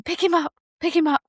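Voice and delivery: high, urgent repeated plea. Retold or imitated. Mother urging husband after the birth, high and urgent.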